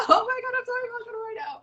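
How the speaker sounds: high, fast, teary voice on the edge of crying; real tearful emotion. Real, not acted. Guest thanking host live, overcome and tearful. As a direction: let the tears break into the words. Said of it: "trying not to cry".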